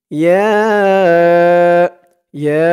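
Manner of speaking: ইয়া with a very long held vowel; three-alif stretch. Shown as a demonstration. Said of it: "এখানে তিন আলিফ টান হবে". (ইয়া লম্বা টেনে উচ্চারণ)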